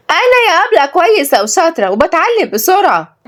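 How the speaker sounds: put-on high-pitched child voice; eager little girl. Girl boasts to her teacher, high and eager. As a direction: pipe up high and proud.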